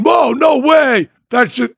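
loud, high, slow "Whoa, no way"; stretched-out excited gasp; amazed reaction. Retold or imitated. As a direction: act blown away by the trick. Acting amazed audience reaction.

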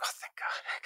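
relieved whisper, barely voiced; whispered relief. Actor privately relieved after the director's approval, whispered.

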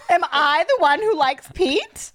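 ordinary delivery

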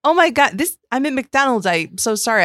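high-pitched put-on embarrassed surprise; flustered mock apology. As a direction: play caught out, voice jumping up. Acts out planned flustered apology.

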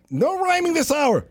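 high mock host; Vizzini scolding. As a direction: snap it from up high. Repeating Vizzini line.

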